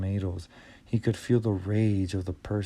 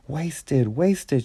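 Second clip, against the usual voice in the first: raised, higher angry shout; 5 semitones higher, 3 dB louder than usual. A narrator's reading. Team leader shouts over smashed crates, angrily. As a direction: team leader yelling at the wreckage. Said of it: "Chugger was shouting".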